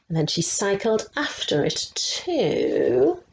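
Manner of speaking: plain reading voice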